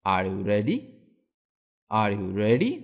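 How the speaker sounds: low rising question. Shown as a demonstration. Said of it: "তুমি কি প্রস্তুত?".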